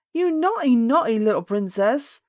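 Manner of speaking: loud scolding nurse cry; furious nurse scolding loudly; crying out angrily. A narrator's reading. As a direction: scold the child, voice rising high and loud. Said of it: "cried Ludi".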